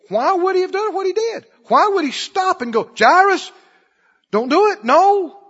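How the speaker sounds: high, loud preacher's cry; preaching at full force. Acted.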